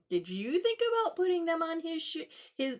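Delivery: ordinary delivery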